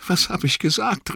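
high, distraught cry; bestürzter Ausruf. A narrator's reading. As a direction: cry out in dawning remorse. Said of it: "rief er". (bestürzt und reuevoll gerufen)

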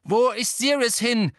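loud, high, urgent question; calling after someone. A narrator's reading. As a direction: shout it after someone leaving. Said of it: "rief Harry dem Elfen nach".

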